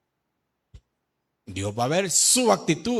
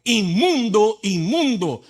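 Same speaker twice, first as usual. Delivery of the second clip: louder, higher warning cry; 5 semitones higher, 4 dB louder, much slower than usual. Acted. Leper warns people not to come near, crying out. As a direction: call it out slowly to people far off. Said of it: "De lejos están gritando".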